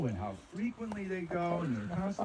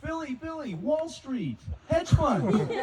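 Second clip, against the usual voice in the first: mock yell; 11 semitones higher, 4 dB louder, slower than usual. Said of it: "I'm yelling".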